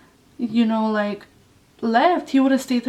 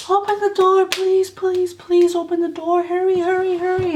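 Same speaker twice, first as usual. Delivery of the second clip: high urgent plea for the door; imitating a frantic call for help; retold yelling; 8 semitones higher than usual. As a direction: plead and yell to be let in, high-pitched. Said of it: "I was yelling his mom's name".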